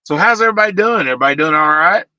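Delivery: high, loud hello to viewers; upbeat greeting. Real, not acted. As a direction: call out cheerfully to everyone.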